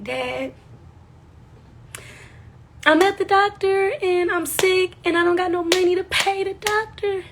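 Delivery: high, tearful, breaking voice; crying to dad. Retold or imitated. Crying on the phone to her dad, high and tearful. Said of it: "I just bust out crying on the phone".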